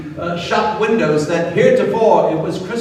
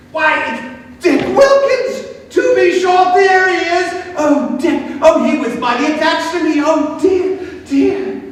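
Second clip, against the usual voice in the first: put-on higher, eager old man's exclamations; excited delight; 7 semitones higher than usual. A narrator's reading. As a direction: Scrooge fondly recognizing his old friend. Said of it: "Scrooge said".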